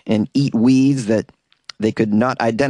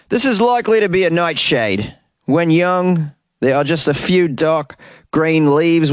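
a lightly put-on Australian accent, higher; mock the scientist in a weak Australian accent; bad Australian accent; 4 semitones higher than usual. Host quoting the botanist in a bad Australian accent. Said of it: "the worst Australian accent ever".

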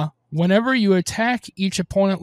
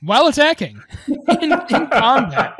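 laughing; 6 semitones higher, 4 dB louder than usual. Real, not acted. Laughing at a crazy card.

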